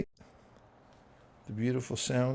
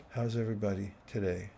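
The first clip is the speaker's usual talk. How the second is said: ordinary talk, nothing marked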